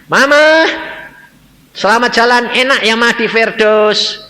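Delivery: loud, high, joyful-sounding farewell; calling out. Retold or imitated. Son entering to bid dead mama farewell, calling out. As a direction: call it high and loud, stretching the end.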